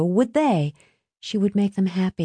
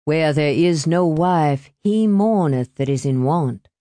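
usual speaking voice

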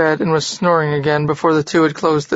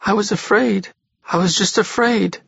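higher, tearful and small; sobbing; 4 semitones higher than usual. A narrator's reading. Ona to Jorgis, frightened after the night away, sobbing. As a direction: choke the words out through tears. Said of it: "sobbed Ona".

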